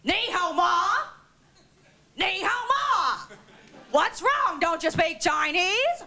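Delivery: high, slow, in-your-face questioning; pushy Jane Meadows. Retold or imitated. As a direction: lean right into her face and press. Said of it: "and she goes".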